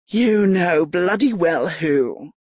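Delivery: slow, menacing lord; cold anger. A narrator's reading. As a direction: bite each word slowly. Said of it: "jaw flexed angrily".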